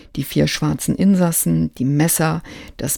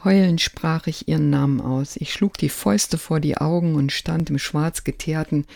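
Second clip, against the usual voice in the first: ordinary delivery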